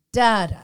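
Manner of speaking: "da-da," with a loud first da and weaker second; trochaic stress. Shown as a demonstration. Host demonstrating trochaic meter, stress on first syllable. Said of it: "The first syllable is stressed".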